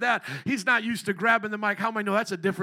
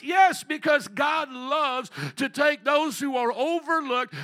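fiery call and response; 7 semitones higher, 6 dB louder than usual. Acted. Pastor leading the crowd to shout Chicago for Jesus, loud. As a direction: make them shout it back. Preaching at full force.

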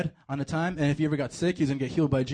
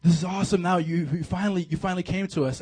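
excited disciples; 4 semitones higher, 6 dB louder than usual. Retold or imitated. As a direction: gush like a disciple who finally sees his king. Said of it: "they're like".

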